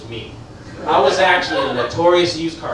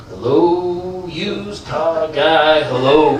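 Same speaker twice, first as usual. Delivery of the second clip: slow, drawn-out crooner voice; 5 dB louder, much slower than usual. Retold or imitated. Star greeting the car salesman in a slow crooner voice. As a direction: croon each hello like a lounge star. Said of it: "this is [name]".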